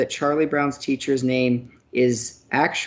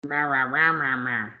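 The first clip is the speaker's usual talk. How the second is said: Charlie Brown teacher; 3 semitones lower than usual. Pretend. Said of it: "teaching Charlie Brown?".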